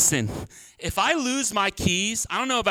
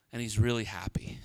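hushed mock joy; 11 semitones lower, 7 dB quieter than usual. Pastor mocks Jonah's happiness.

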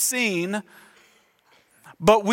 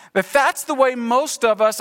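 ordinary talk, nothing marked

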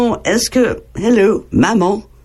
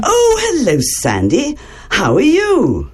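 delighted greeting; 10 semitones higher than usual. Acted. Teacher greets puppet Sandy brightly.

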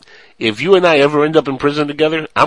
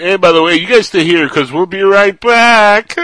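loud, high, hammed-up cheerful send-off; doomed movie character; 7 semitones higher, 7 dB louder than usual. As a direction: call back to the group, stretching the end. Voices doomed horror movie character.

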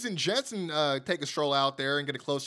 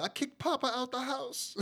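a hint of a mocking higher Waller voice; imitating Grayson Waller; 7 semitones higher, 5 dB quieter than usual. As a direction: lightly mimic the wrestler, higher. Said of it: "he was like".

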